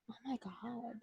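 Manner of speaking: breathless whispered gasp of disbelief; shocked whisper. Retold or imitated. Commentators watch the catty women, whispering in shock. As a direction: hush it, as if you can't believe your eyes.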